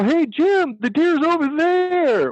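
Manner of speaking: high, drawn-out mock holler; calling out. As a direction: imitate hailing a hunting buddy at a distance. Hunter calling out to a companion.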